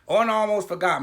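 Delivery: ordinary delivery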